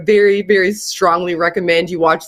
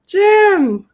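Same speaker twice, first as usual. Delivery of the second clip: higher-pitched call down the line; 10 semitones higher than usual. Real, not acted. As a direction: call out to the caller who isn't answering. Calling an unresponsive caller by name.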